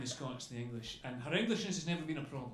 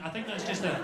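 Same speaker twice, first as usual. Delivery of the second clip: normal talking voice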